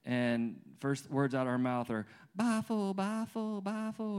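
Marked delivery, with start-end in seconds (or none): 2.3-4.2 high, soft imitation of a crying toddler. Dad mimics his hungry two-year-old on waking, crying toddler voice. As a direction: mimic a little girl whining for food, high-pitched. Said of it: "And she's crying".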